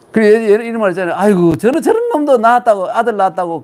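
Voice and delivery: fast, high and loud scoffing; mock scoff. Lecturer quotes a scornful saying, fast and loud. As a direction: scoff at the good-for-nothing.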